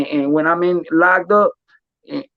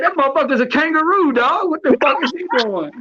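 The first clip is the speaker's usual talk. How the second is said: high, laughing retold quote, cracking with amusement; laughing disbelief; 10 semitones higher than usual. Retelling a friend's clumsy fight, laughing high.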